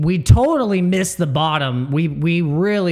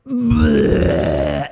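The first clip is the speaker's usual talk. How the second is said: mock dump noise; 8 semitones higher, 4 dB louder than usual. Imitating market dump.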